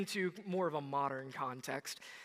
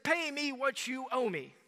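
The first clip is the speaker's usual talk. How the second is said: higher, harsh demand; demanding servant; 6 semitones higher, 3 dB louder than usual. Acted. Servant throttles his fellow servant for the debt, a stern demand. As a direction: seize him by the throat and demand it. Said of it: "seized him by the throat and choked him and said".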